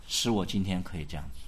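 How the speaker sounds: a touch of humble testimony. Pretend. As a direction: say it low, almost to yourself. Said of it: "他说".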